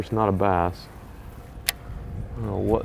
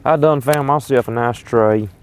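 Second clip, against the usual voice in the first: louder hillbilly twang on I done; 2 semitones higher, 5 dB louder than usual. Pretend. Snagged lure, repeats with twang.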